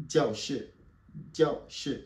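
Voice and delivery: "Jiao" and "shi" both sharply falling; falling tones. Shown as a demonstration. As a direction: hit the fourth tone on "Jiao shi". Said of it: "Quatrième ton".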